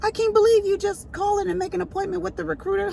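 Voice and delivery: put-on high-pitched whiny teenage protest; exasperated son, hammed up high; mocking whiny son. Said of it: "he was like".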